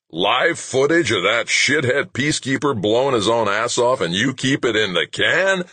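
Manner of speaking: high-pitched, indignant roar. A narrator's reading. Boss berating his news staff over withheld footage, roaring. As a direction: roar it in outraged disbelief. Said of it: "McGrath roared".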